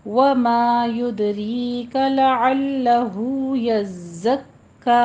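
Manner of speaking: slow measured Quranic chant; tajweed recitation. Shown as a demonstration. Teacher models the verse for students, slow recitation. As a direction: draw each vowel out like a reciter.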